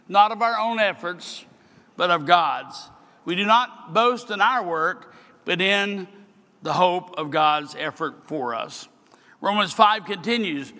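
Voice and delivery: usual speaking voice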